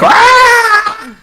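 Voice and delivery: high, laughing goblin squeal; a wild shriek while waving the oil. Retold or imitated. Goblin waving a jar of oil, high laughing shriek. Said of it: "shaking it in the air like".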